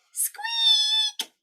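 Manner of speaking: very high squeak; tiny mouse squeak. Acted.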